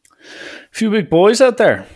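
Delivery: pointed, sing-out taunt; needling remark. Retold or imitated. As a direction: toss it out high and slow to needle the rival. Coach needles rival coach in retold exchange.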